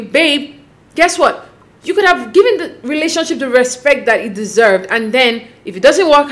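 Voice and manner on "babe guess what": normal talking voice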